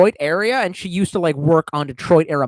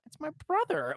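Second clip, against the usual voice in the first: mock girl reply; 11 semitones higher, 12 dB quieter than usual. Her reply in dialogue.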